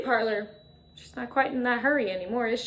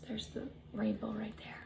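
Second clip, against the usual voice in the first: whisper; 16 dB quieter than usual. Shown as a demonstration. Pointing at the picture.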